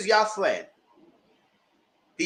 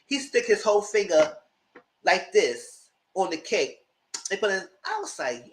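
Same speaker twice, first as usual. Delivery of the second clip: usual speaking voice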